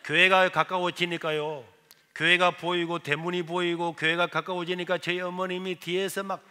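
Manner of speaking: normal talking voice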